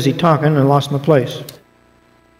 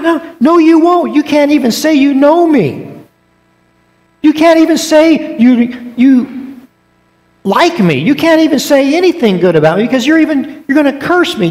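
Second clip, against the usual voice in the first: chiding Jesus; 10 semitones higher than usual. Acted. Jesus telling Peter he will deny him, chiding. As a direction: scold him knowingly.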